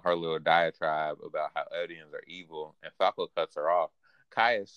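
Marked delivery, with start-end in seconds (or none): none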